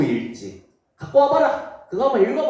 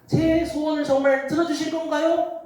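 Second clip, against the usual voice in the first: higher, timid pleading voice; pleading queen; 12 semitones higher than usual. Acted. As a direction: ask the king carefully, hoping. Queen Esther asks the king. (높은 목소리로 조심스럽게 묻는 왕비)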